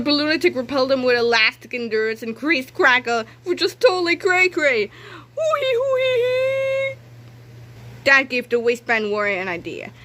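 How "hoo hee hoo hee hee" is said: very high slow hoo-hee laugh; crazy high cackle. Acted. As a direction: cackle like a loony balloon.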